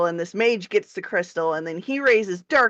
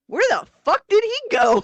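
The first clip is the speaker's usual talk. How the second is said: mock bafflement; 10 semitones higher than usual. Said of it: "you're like".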